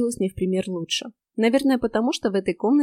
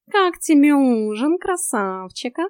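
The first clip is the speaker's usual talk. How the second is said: slow, raised, needling cat voice; snide cat; 6 semitones higher, 5 dB louder, slower than usual. A narrator's reading. Cat teases heroine about the handsome vampire's dinner, snide. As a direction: tease with a drawn-out, high start. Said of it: "ехидно уточнил Васька".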